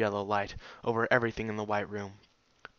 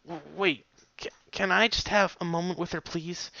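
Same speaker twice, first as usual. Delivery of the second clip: stammering, pleading and higher; imploring; 7 semitones higher than usual. A narrator's reading. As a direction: beg her to wait, voice catching. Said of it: "she implored".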